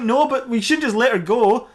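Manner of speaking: slow, high play-acted protest; pleading objection. Officers answering their boss about releasing her, pleading. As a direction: drag it out high, mock-pleading.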